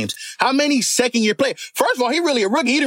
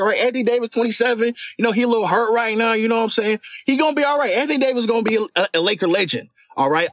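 ordinary talk, nothing marked

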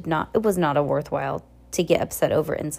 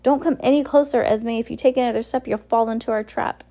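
raised, higher voice of warning; urgent cry; 5 semitones higher, 4 dB louder than usual. A narrator's reading. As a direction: call out loudly to stop them. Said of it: "Quigley cried".

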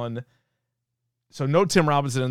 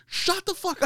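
much higher put-on voice snapping at the neighbour; mock rude snap. Ponytail guy hammed up, telling the helpful neighbour to shut up, high mock snap. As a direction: squeal the insult in a high cartoon voice. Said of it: "He goes".

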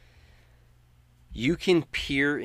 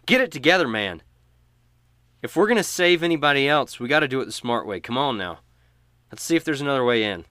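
loud, higher and quick; stern urging; 5 semitones higher, 9 dB louder, faster than usual. Acted. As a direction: shake him into focus, firmly. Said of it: "and she says".

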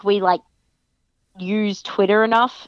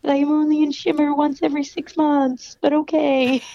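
mock exasperated; 7 semitones higher than usual. Telling fans of her home promotion, with mock indignation. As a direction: act out her frustration, pitched up. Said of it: "And I'm like".

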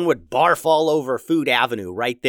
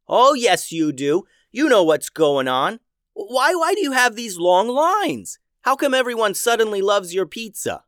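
put-on higher, louder, accusing kid; 9 semitones higher, 4 dB louder than usual. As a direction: push the accusation, pitched up. Simon accusing the robot.

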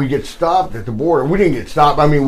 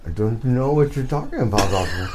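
imitating the Sheik; 5 semitones lower, 5 dB quieter, faster than usual. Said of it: "Like that".

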